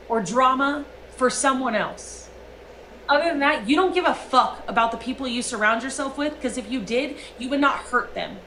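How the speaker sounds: ordinary talk, nothing marked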